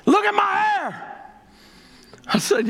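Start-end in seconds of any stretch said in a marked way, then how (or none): 0.0-1.0 jubilant retold cry. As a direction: cry it out high, showing it off. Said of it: "with this big old smile".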